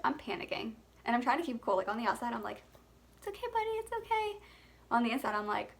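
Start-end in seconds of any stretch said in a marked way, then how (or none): 3.2-4.4 put-on high, sing-song reassurance to a baby; sweet high coo, pretending everything's fine; soothing baby voice. Mom keeping cool for her choking baby, high soothing coo.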